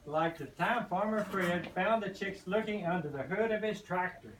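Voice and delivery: usual speaking voice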